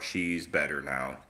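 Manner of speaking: ordinary talk, nothing marked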